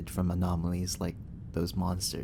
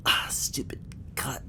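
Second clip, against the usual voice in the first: pained whisper; 4 semitones higher, 5 dB quieter than usual. Acted. Hurt, muttering about a cut.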